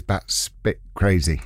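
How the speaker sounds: low, spaced word by word; very slow, careful. Real, not acted. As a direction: take it very slowly to make sure. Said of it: "You've got to say it very slowly".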